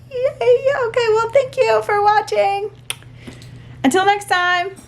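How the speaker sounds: high, bubbly sign-off; excited squeal. Real, not acted. Signing off to viewers, high and giddy. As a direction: squeal with delight as you say goodbye.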